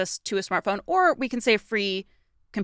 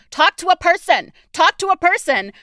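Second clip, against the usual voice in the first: louder, insistent repeated demand; demanding a person; 8 semitones higher, 7 dB louder than usual. Retold or imitated. Caller pleading with a phone robot, insistent and loud. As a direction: call out to the phone robot, impatient. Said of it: "line and going".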